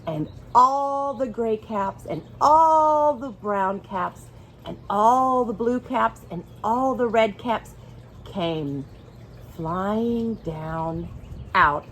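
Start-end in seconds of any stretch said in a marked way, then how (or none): none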